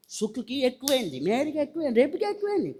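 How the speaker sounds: soft, put-on grumble about others; mock envious whining. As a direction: mimic petty jealous griping in a thin undertone. Mimics envious complaint about others. (అసూయతో గొణుగుతున్నట్టు అనుకరణ)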